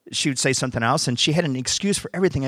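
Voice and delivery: ordinary talk, nothing marked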